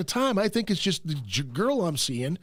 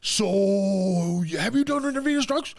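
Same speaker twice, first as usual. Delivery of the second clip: quoting the doctor, pitched well up and quick; a nervous doctor firing questions at his patient; doctor's questions; 9 semitones higher than usual. Retold or imitated. Doctor to patient, pitched up and rattled. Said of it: "He goes".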